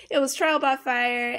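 trial by fire with a light Southern drawl; slipping Southern accent. Real, not acted. Telling viewers about early parenting in a faint Southern accent. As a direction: catch yourself sounding a bit Southern. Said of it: "I did it again!".

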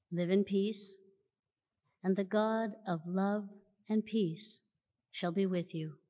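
normal talking voice

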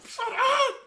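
high yell breaking into a laugh. Real, not acted. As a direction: yell it while cracking up. Same words, now laughing.

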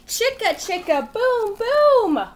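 loud high chant as the tree falls; dramatic crash. Acted. Teacher loudly chanting the crash for listening kids. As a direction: shout the crash like a big reveal.

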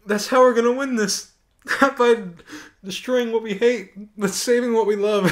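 can barely get the line out for laughing; mocking Rose's speech while cracking up; quoting Rose, laughing. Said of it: "she tells him".

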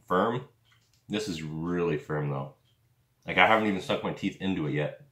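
chewing while talking; speaking between bites; mouth full of jerky. Real, not acted. Seen 2: Bites the jerky stick before 0, then chews while holding it near his chin.

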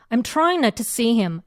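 very slow, "trying" pressed; stress on trying. Shown as a demonstration. Teacher modelling the line, slow stress on "trying". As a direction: lean on "trying", slowly. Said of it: "요렇게 강세는".

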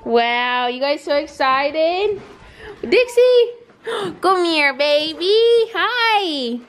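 high, stretched cooing to the dogs; real high-pitched doting greeting; excited pet talk. Real, not acted.

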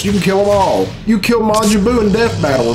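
ordinary delivery

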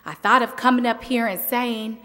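ordinary talk

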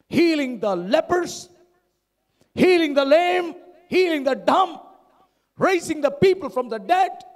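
high, ringing list of miracles; punchy preaching with a pause between phrases; forceful preaching. Real, not acted. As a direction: drive each phrase home from the pulpit.

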